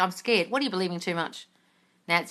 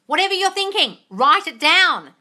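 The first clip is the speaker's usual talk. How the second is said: loud, high command, "down" stretched; emphatic instruction; 7 semitones higher, 7 dB louder than usual. Real, not acted. Coach cuts through the inner thoughts, emphatic instruction.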